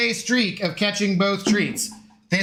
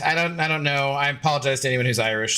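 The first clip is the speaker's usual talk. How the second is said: ordinary talk, nothing marked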